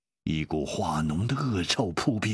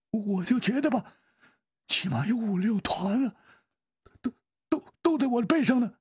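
high, thin, nervous stutter; frightened stammer; 11 semitones higher than usual. A narrator's reading. Zhao reports creatures on his back, frightened stammering. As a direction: stammer anxiously, pitched way up.

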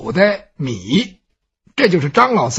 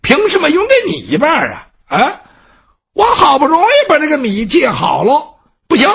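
raised pitch, glaring protest; indignant thief; 6 semitones higher than usual. Acted. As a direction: refuse him with wide-eyed indignation. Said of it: "眼珠子一立楞".

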